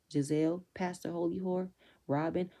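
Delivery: normal talking voice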